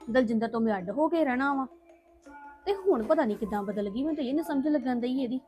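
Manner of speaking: soft, sulky muttering to herself; quiet grumbling. Acted. Daughter muttering to herself after the family fight, quiet and sulky. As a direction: grumble under your breath, alone and resentful.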